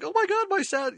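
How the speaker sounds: hammed-up delight over the tree; mock gushing. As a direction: squeal like the office crowd. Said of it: "they were all going".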